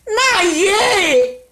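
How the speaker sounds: high, loud retort; high, loud mocking retort; mock reply. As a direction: toss it back high and loud.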